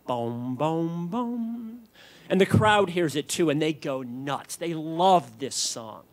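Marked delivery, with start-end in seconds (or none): 0.1-1.8 imitated bass line, climbing in pitch; soft, rising musical booms; spacey bass notes. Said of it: "that spacey".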